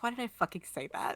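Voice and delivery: hushed, quick self-reproach; questioning himself. Acted. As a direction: mutter it quickly to yourself, baffled. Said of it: "like he's questioning himself".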